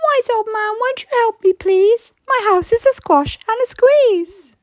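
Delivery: high, whiny plea for help; pleading old lady. Acted. Old lady begging the wise old man for help, high and pleading.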